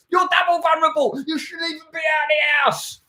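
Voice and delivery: high put-on shout, scolding; shouting copper. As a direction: shout him back indoors like a cop. Acted jogger stopped by officer.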